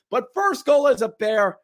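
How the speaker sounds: high, loud excited play-by-play shout; goal call. Acted. Vlogger recreating the goal broadcast, excited shout.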